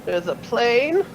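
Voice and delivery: put-on old man voice. GM repeats the old man's line.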